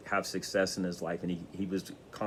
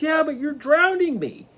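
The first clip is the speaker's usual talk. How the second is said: high, loud, desperate objection; frantic protest; 7 dB louder than usual. Retold or imitated. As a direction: cry out in outrage. Man protesting to wise man.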